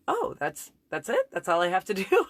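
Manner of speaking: high, incredulous with a laugh; laughing surprise. Retold or imitated. Husband surprised it's so easy, laughing. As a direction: sound amazed it's so simple, laughing. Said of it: "he was like".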